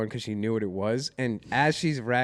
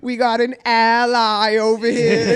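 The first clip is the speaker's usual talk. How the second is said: high, loud, slow Cockney jeer; British heckler playing to friends; British accent; 8 semitones higher, 9 dB louder, slower than usual. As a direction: announce it in a hammed-up British accent. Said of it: "she was in a British accent".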